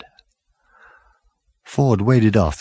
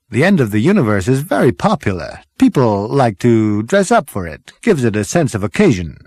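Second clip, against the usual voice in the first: excited Zaphod. Acted. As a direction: gush like a dazzled party boy. Zaphod character voice.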